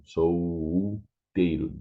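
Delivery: solteiro slowly, l as u. Shown as a demonstration. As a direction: drag solteiro out, no l. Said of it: "It's not the L sound".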